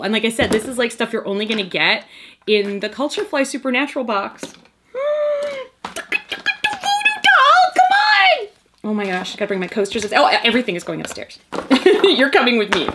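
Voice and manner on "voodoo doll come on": high, slow delighted shriek; excited shriek. Real, not acted. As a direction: shriek with joy at the gift. Gasp, shout at voodoo doll.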